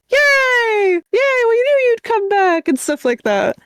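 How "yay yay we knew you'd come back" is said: high, excited mock cheer from the townsfolk; hammed-up villagers' cheer; cheering crowd. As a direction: squeal it like a crowd greeting a hero. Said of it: "everyone else is like".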